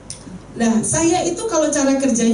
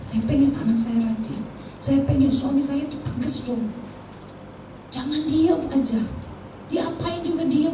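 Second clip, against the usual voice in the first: crying confession; 4 semitones higher, 5 dB quieter than usual. Retold or imitated. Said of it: "dia nangis".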